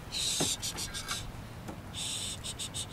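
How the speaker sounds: soothing shush. Real, not acted. Calming the resisting dog.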